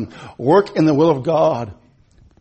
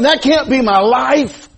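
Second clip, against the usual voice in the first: higher, louder, slow preached declaration; emphatic; 8 semitones higher, 4 dB louder, slower than usual. Acted. Preacher insists to the church, loud and slow. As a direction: hammer it home. Preached line then echoed softly.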